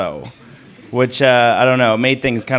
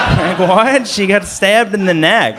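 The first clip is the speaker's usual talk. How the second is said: high-pitched, indignant disbelief; baffled outrage at the absurdity; incredulous; 6 semitones higher, slower than usual. Retold or imitated. Incredulous reaction to operator's demand.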